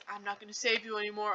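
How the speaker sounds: quiet, dramatic retold vow; mock dramatic. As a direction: drop soft and ominous, lightly hammed-up. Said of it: "all dramatically".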